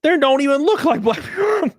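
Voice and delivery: high, loud disbelieving outburst; incredulous shout. Retold or imitated. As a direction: yell it like it's absurd. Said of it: "I was like".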